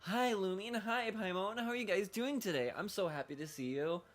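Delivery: high-pitched mock friendliness; chirpy greeting. Player imitating how the character greets them, over-friendly. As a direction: gush a hammed-up hello, easing at the end. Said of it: "it should be like".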